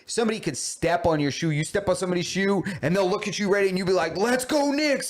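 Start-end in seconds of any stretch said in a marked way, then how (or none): 4.2-5.1 high-pitched rallying fan call; rally the crowd, pitched way up; fan cheer. Acted. Fan to a stranger after a stepped-on shoe, rallying cheer.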